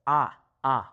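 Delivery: a as an open ah, held apart; pure "ah" for each a; Spanish a. Shown as a demonstration. Teacher corrects the vowel, open Spanish ah for a. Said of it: "it's a".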